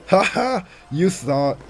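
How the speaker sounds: loud, high mocking "Ha ha!"; cocky loud laugh; taunting laugh. Real, not acted. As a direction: gloat at the opponent's mistake. Taunting the opponent.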